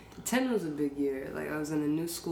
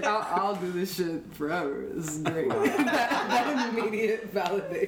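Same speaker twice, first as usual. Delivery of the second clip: laughing through the words; real laughter, bubbling up; 4 semitones higher than usual. Real, not acted. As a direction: giggle while you say it. Laughing at her childhood delight.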